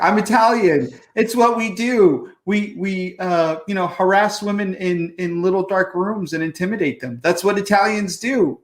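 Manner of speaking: high, loud hammed-up Italian tough guy; mock Italian guy. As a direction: play a boastful mobster type. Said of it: "I love how he's like".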